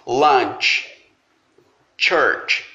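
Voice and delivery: lunch and church with a TCH sound, like tchê; English CH. Shown as a demonstration. Teacher models the CH sound, clearly. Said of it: "som de ch".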